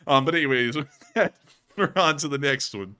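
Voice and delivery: ordinary delivery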